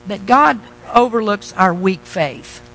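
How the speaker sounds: ordinary talk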